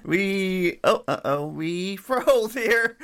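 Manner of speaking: laughing through the words; laughing at the freeze, drawn out. Real, not acted. Stream freezes mid clip.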